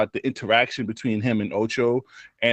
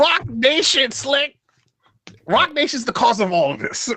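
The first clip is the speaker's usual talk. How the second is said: high mock-serious; 12 semitones higher than usual. Real, not acted. Joking conspiracy claim.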